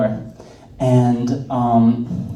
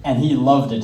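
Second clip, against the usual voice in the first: normal talking voice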